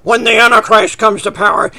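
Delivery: a hint of smugness, retold; lightly imitating a smug professor; smug reply. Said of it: "he answered me smugly".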